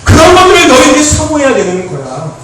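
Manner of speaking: loud, high, driving sermon delivery; fired-up preacher shouting to the youth; full-force preaching. Real, not acted. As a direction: hammer each line home at full volume.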